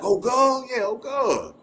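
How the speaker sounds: put-on high drawn-out "Old girl?" then a drawled agreement; Southern drawl. Man plays a Southern guy answering, high drawl. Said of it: "You be like".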